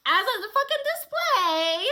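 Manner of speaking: loud, high, drawn-out exclamation; exasperated outburst. Real, not acted. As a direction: blurt it out loud and stretched. Exasperated outburst over candy display.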